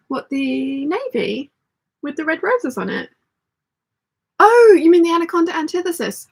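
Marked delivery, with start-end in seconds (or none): 4.4-6.3 loud, high "oh" of recognition; louder, higher, catching on suddenly; sudden realisation. Real, not acted. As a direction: burst out as it dawns on you. Sudden realisation while reading chat.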